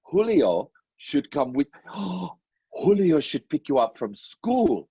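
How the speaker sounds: slow, hammed-up dancer persona; Julio. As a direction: tease slowly in a put-on showman voice. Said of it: "How about if Julio comes out?".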